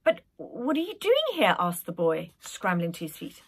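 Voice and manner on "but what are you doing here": put-on lightly higher young boy; a hint of a curious little prince. Boy's question in story.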